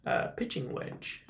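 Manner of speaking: hushed golf commentator voice; soft, whispery golf call for TV. Shown as a demonstration. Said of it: "I wanted to say it like a golf announcer".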